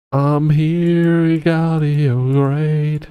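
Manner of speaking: high, slow, grand boast; heroic champion voice. Pretend. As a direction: announce yourself like a hero. Voicing Galio, game champion.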